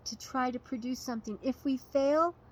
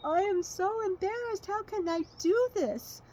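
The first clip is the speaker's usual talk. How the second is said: put-on a boy crying in shame; tearful despair; 8 semitones higher than usual. Ping cries in shame as the emperor approaches, higher and tearful. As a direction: voice pitched higher, near tears and pleading. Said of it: "started to cry and cry".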